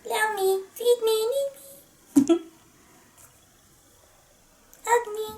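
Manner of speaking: put-on high, singsong pleading baby voice; cute baby figure. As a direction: beg sweetly as a tiny baby toy.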